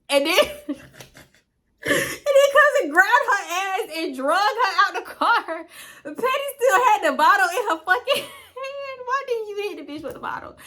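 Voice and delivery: high squeal of laughing speech; real helpless laughter. Real, not acted. Storyteller retelling friend dragged from car, laughing helplessly. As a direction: crack up while trying to finish the sentence. Cracking up while retelling fight.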